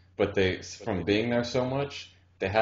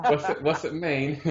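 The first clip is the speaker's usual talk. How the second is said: higher-pitched questioning through laughter; imitated curious girl; 6 semitones higher than usual. Retelling the girl's repeated question to friends, laughing imitation. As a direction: mimic her insistence, amused.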